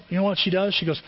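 ordinary delivery

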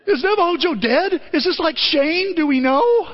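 worried viewer wondering aloud; anxious moviegoer. Retold or imitated. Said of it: "you're going".

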